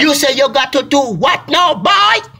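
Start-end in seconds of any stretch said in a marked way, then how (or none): 1.7-2.3 a high, loud disbelieving yell; incredulous shout. Acted. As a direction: throw the question back in shock. Mock disbelief at voice exercises.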